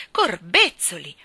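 loud, drawn-out exclamation; emphatic astonishment. A narrator's reading. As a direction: burst out slowly in amazement. Astonished keeper repeats it louder. (stupore esclamato a voce alta)

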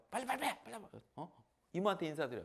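urgent high hurrying; mother urging her son to greet; hurrying the child. Retold or imitated. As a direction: hurry the kid along.

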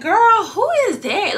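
acted high-pitched bewilderment; alarmed question. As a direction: shoot way up high, as if retelling your shock. Acting out her alarmed question.

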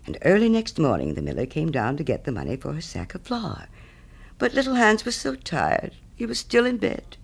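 ordinary delivery

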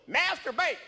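high, sudden mock yell; waiter's mock yell. Waiter yelling potato options at customer, high mock yell. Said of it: "He just yells at me".

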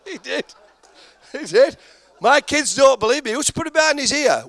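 rising high and loud, pleading; insistent. Real, not acted. Comic insisting to a doubtful crowd, excited and high. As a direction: push it up, begging them to believe. Insisting the audience believe him.

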